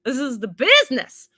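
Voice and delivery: loud, delighted "this is the business"; excited exclaim. Retold or imitated. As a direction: proclaim it like a great find. Excited aside after "I was like.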